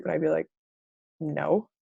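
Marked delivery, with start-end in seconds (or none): 1.2-1.7 low, deadpan no; grumpy refusal. Acted. Reader in a bad mood answering a text, grumpy. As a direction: drop it low and dismissive. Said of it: "If I'm in a shitty mood".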